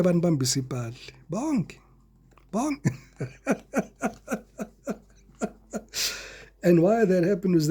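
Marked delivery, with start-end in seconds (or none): 1.3-2.9 high squeaky repeated Bonke; mock-shocked Bonke; incredulous bonke. Real, not acted. Mocking exclamation, high. 3.2-4.4 high giggle; amused chuckle; laughing. Real, not acted. Laughs after bonke.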